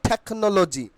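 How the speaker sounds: "টেকনোলজি" said thek-no-lo-ji; breath after the t in "টেকনোলজি"; corrected pronunciation. Shown as a demonstration. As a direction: say "টেকনোলজি" with a puffed t. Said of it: "তাহলে এটা উচ্চারণ কি হচ্ছে?".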